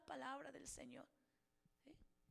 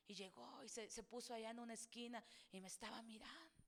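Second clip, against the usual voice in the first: hushed storytelling; 5 semitones lower, 10 dB quieter than usual. Acted. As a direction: drop way down to a near whisper. Relato bajado a casi susurro. (relato en voz baja, casi susurrando)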